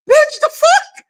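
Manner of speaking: very high incredulous squeal; squeaky, hammed-up bafflement; mock disbelief. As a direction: act out being floored, pitch shooting up. Acted reaction to the insult.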